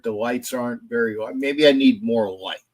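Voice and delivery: ordinary talk, nothing marked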